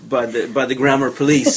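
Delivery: chuckling through the words; laughing. Real, not acted. Scene: coach jokes to the interviewer, laughing as she talks. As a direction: let the laugh bubble into the joke. Joking about the grammar police.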